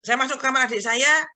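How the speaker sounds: ordinary delivery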